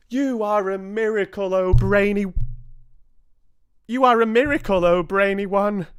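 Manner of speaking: high, loud, gushing praise; adoring witches hailing their leader; excited cheering. A narrator's reading. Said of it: "yelled the audience".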